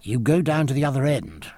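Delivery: ordinary delivery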